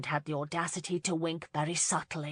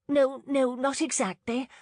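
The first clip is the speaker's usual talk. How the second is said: stammering; 8 semitones higher, 4 dB louder than usual. A narrator's reading. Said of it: "Lauren stammered".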